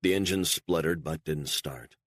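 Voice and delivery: plain reading voice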